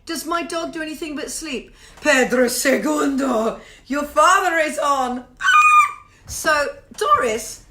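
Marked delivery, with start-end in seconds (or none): 2.0-5.3 loud, slow, drawn-out summons; big theatrical holler; calling out. Pretend. Calling out to Pedro. 5.4-6.0 very high, loud squeal; short piercing cry; shriek. Pretend. Shriek after calling Pedro.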